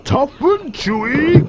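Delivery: high, drawn-out incredulous question; slow, soaring crocodile outrage; indignant cry. A narrator's reading. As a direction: echo it in outraged disbelief, way up high. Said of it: "cried the enormous crocodile".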